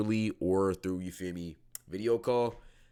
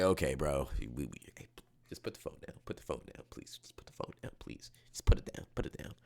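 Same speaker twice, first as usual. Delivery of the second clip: very soft, low, near-whispered coaxing; hushed pleading; 8 semitones lower, 4 dB quieter than usual. Acted. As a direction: gently talk a friend down under your breath. Acting out calming a conspiracy friend.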